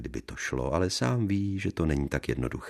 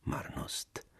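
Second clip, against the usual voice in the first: quiet melancholy; 13 dB quieter than usual. Acted. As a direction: melancholy whisper. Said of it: "říkala si potichu melancholicky". (melancholicky šeptem)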